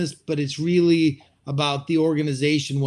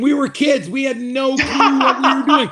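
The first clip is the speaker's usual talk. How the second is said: high, louder, laughing disbelief; half-laughing confession; 9 semitones higher, 6 dB louder than usual. Real, not acted. Laughing about signing huge loan.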